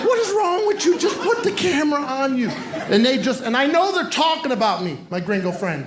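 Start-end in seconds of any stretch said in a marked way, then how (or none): 0.1-2.5 high exasperated cry. Retold or imitated. Husband exasperated at mother-in-law's Skype, high and fed up. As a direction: yell it, fed up with the bad webcam. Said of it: "you're like".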